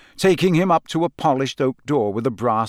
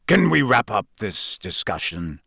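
growled; 3 semitones lower than usual. A narrator's reading. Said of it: "growled Moody".

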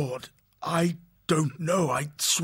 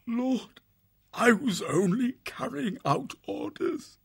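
far higher, small frightened voice; whimpering after a sob. A narrator's reading. Sobbing prisoner confessing to his warlord, whimpering. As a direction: squeak it out through the fear. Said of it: "gave a prolonged whimpering sob, then spoke".

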